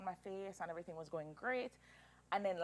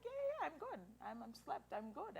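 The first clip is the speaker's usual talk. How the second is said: reassuring; 6 semitones higher, 6 dB quieter than usual. Retold or imitated. Reassuring doctors after waking.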